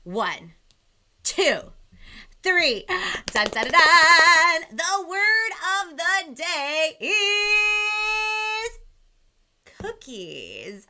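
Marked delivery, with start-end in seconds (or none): 3.3-4.8 loud, slow vocal drum roll; mouth drum roll. Pretend. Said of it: "a drum roll or a round of applause". 4.8-10.9 dramatic announcement. Acted. Announcing the word of the day.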